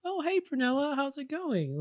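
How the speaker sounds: high, soft, sing-out greeting; friendly lunch lady. Acted. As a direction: greet a child lightly in a high, gentle voice.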